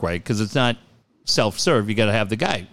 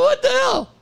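very high, cracked-up disbelief; dying laughing; 3 dB louder than usual. Retold or imitated. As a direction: retell it as if cracking up, voice way up. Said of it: "she and I are dying".

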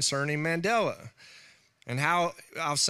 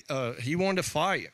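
usual speaking voice

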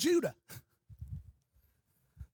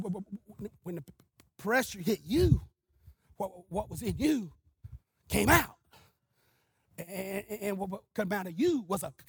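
Brother Buddy's stutter. Retold or imitated. Stuttering evangelist tells preachers what came out, halting stammer. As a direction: stick on first sounds, with long gaps. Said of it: "He just stuttered".

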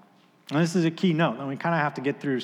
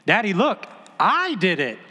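put-on higher, louder, thrilled little girl; excited child; 6 semitones higher, 4 dB louder than usual. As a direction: cheer like a proud four-year-old. Daughter proclaims in excitement.

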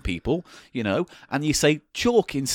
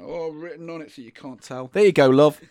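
normal talking voice

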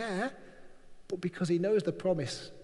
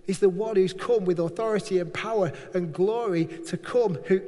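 normal talking voice

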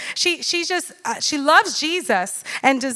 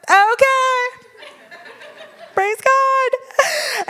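much higher, strained praise; forced cheer; 11 semitones higher, much slower than usual. Retold or imitated. Mother nervously approving her daughter's blackbird, strained high cheer.

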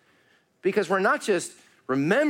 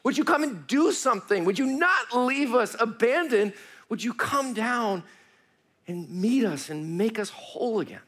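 crying out to God; 6 semitones higher than usual. Acted. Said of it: "they're crying out".

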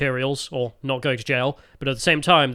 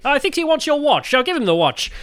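higher-pitched British airman chap; 10 semitones higher, 3 dB louder than usual. Acted. British airman baffled by the Frenchman, high chap voice. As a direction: play a comic English airman, pitched up. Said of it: "the British airmen sp-, speak, perfect English".

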